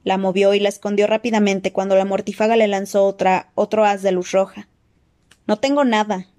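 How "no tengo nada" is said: usual speaking voice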